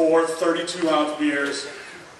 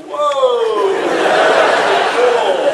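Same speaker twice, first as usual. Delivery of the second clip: put-on high, loud, stretched drunken "Whoa!"; drunken amazement; 7 semitones higher, 9 dB louder than usual. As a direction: marvel at the ball like you're tipsy. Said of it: "I'm just watching the ball go".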